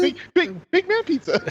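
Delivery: high, put-on goofy voice; hammed-up mishearing; silly voice. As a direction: belt it high as a joke. Mishearing goat pizza joke.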